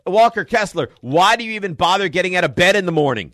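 high, loud exasperated heckle; mock-yell at a player as an outraged kid fan; imitating angry son. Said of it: "He's angry".

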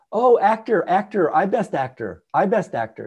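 put-on light Tibetan-accented broken English, giddy and high. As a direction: imitate Lama Yeshe's excited broken Tibetan English, lightly done. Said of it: "in his broken English".

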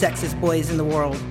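ordinary delivery, nothing marked

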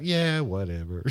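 slow, resigned, deflated; hangdog mumble. Retold or imitated. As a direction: shrug it off, defeated. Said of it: "he sounds so hangdog about it".

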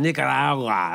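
"Nicaragua" with showy Spanish sounds; newscaster's Spanish pronunciation. Retold or imitated. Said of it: "try to pronounce the Spanish words".

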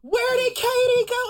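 high, slow, drawn-out call down a hall; drunk friend calling out. Retold or imitated. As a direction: stretch her name out like a tipsy friend searching. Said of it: "calling my name down the hall".

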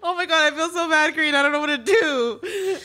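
real teary laughter breaking into the words; crying and laughing. Real, not acted. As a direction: laugh and cry at the same time. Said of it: "I'm, like, crying and laughing".

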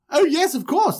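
high, eager helper; bright eager-to-please reply; eager Joseph. Retold or imitated. Joseph agreeing to fetch lunch, high and eager. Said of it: "And he's like".

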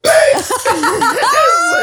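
a big howl of laughter; loud laugh. Real, not acted. Recalling the kid coming back, loud laugh.

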